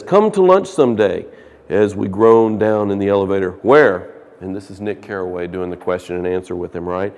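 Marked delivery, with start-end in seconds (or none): 0.0-4.1 loud, projected reading from the book; reading a novel aloud. Acted. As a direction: read the passage out to the class. Reads the novel passage aloud.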